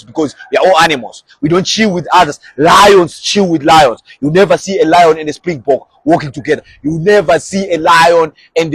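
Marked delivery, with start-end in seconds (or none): 2.6-4.0 slow emphasis. Shown as a demonstration. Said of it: "slow emphatic statement".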